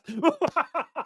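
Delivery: high-pitched burst of laughter. Real, not acted. Laughing at his own joke.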